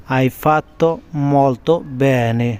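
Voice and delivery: "Hai fatto" then a pause, then "molto bene" drawn out slowly; slow split teaching. Shown as a demonstration. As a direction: break it in two and say it slowly for learners.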